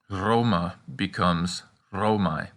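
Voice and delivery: "Romae" ending in an eye sound, said slowly; diphthong demo. Shown as a demonstration. Teacher shows Latin ae diphthong slowly. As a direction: contrast "Roma" and "Romae" slowly. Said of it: "it's pronounced I".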